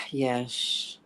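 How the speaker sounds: low, soft answer after a sigh; sigh, then let it out low and quiet; reluctant sighing yes. Retold or imitated. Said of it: "And I went like this".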